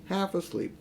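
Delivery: usual speaking voice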